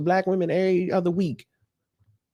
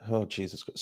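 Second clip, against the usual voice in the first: a low, much softer mutter; muttered exasperation; 9 semitones lower, 12 dB quieter than usual. Real, not acted. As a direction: say it under your breath in disbelief. Repeats reaction, much quieter.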